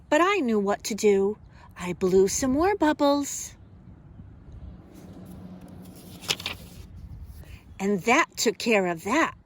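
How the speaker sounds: lively, expressive picture-book reading; reading to children. Acted. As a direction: read it like storytime for little kids.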